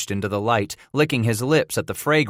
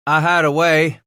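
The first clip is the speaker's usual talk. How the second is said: lightly garbled, talking round food; mouth full; 7 semitones higher, 6 dB louder than usual. Acted. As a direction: speak through a mouthful. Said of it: "Titus garbled around a chunk".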